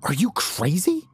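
high, incredulous question rising at the end; lamenting disbelief. A narrator's reading. Larry rushes up to the armed guard, lamenting. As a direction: demand it in dismay, pitch climbing. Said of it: "Larry lamented as he rushed up".

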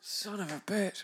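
strained, half-choked curse; hoarse after coffee down the wrong way. Real, not acted. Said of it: "Did the coffee go down?".

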